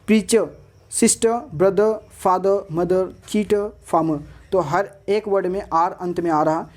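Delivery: usual speaking voice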